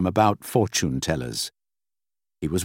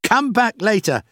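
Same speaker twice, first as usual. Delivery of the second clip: high-pitched, impatient raised voice; impatient call; 14 semitones higher, 4 dB louder than usual. A narrator's reading. Boss calling to Rachel outside his office, impatient shout. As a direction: call out to get rid of the visitor. Still shouting through the door.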